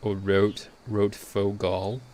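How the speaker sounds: American pronunciation, drawn out slowly; American accent. Shown as a demonstration. Streamer comparing name pronunciations, American accent. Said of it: "say it in an American accent".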